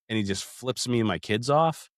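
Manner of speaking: normal talking voice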